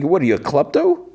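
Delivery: high-pitched incredulous question, rising at the end; mock disbelief, voice climbing on klepto. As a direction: pitch it way up, as if you can't believe it. Narrator mockingly challenges the character.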